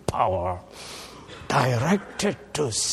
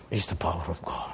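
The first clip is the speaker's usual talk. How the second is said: whisper; 7 semitones lower, 5 dB quieter, much faster than usual. Real, not acted. Preacher drops to a whisper.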